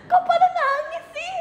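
high-pitched teary laughter; laugh-crying. Real, not acted. Streamer laughs until it sounds like sobbing.

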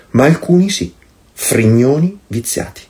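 normal talking voice

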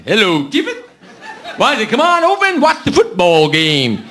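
put-on voice, swooping up then dropping; joke caller. Rufus phoning Tevis about the football game, caricatured caller voice. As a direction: exaggerate a caricatured friendly invitation. Joke's Rufus phones his friend.

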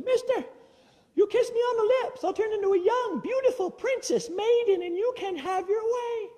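high squeaky frog voice, coaxing; talking frog. Acted. Frog offers to become princess.

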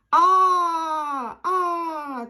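long, drawn-out "aaa" as a dog; dog's open-mouthed cry. Acted. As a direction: open wide and hold the vowel like the dog. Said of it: "песик відкриває рот і каже".

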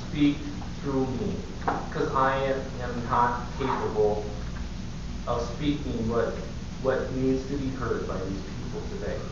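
soft, earnest prayer voice, fading to a near-whisper at the end. Acted. Leads a prayer before sermon.

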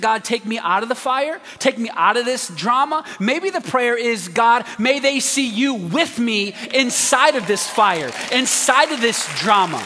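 slow, measured preaching; earnest pulpit prayer; sermon prayer cadence. Acted. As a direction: lay out each prayer deliberately. Modelling prayer.

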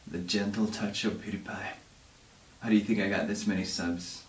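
mock-tender soft voice. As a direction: give them the gentle version. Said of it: "Oh, you want soft?".

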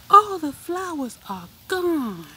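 high, loud gasp of surprise; shocked at the empty board; all gone, big surprise; surprised. A narrator's reading. Surprised discovery of missing flowers.

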